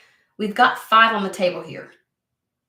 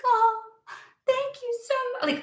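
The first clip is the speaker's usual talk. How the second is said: very high, softer, syrupy fake thanks; mock grateful; 3 dB quieter than usual. Mocking the expected thanks after waiting so long, high and soft. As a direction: sweeten it into hollow gratitude. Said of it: "supposed to just be like".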